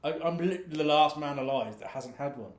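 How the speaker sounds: normal talking voice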